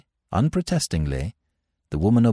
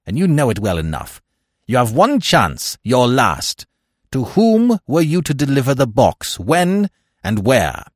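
loud, higher, forceful interrogation; snapped; 6 semitones higher, 6 dB louder than usual. A narrator's reading. Smith interrogating the captive woman, snapped. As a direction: press hard for an answer. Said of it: "snapped Smith".

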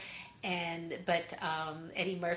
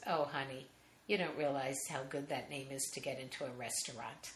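ex-wife calmly explaining the name's perks, lower and softer; suddenly calm; 6 semitones lower, 9 dB quieter than usual. Retold or imitated. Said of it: "totally calms down".

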